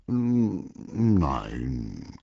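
hesitant, falling admission; reluctant, drawn-out confession; sheepish. A narrator's reading. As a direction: hum first, then own up meekly. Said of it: "gab Walter kleinlaut zu".

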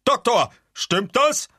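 high, loud, slow demand; shouted disbelief. Acted. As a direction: call out urgently for confirmation. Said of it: "schrie Katzenkissen".

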